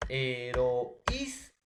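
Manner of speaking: "Heroísmo" said very slowly, syllable by syllable; slow demonstration. Shown as a demonstration. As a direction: draw out "Heroísmo" so the students hear each vowel. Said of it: "la ut". ("Heroísmo" muy lento, marcando cada vocal)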